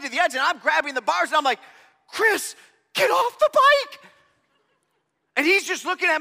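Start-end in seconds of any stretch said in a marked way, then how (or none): 2.1-4.1 high, loud desperate shout of a name; frantic yell. Retold or imitated. To his friend Chris on a sliding bike, a panicked yell. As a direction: yell at him to jump off, slowly and high.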